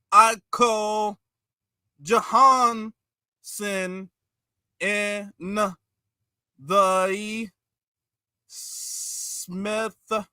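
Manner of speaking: laboured, drawn-out sounding out with long gaps; mock slow thinker. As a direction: mimic someone painfully spelling word by word. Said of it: "You not thinking fast enough".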